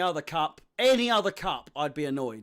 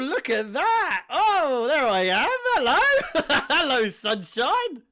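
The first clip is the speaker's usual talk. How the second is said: excited; 7 semitones higher, 5 dB louder, slower than usual. Real, not acted. Sees himself on the broadcast.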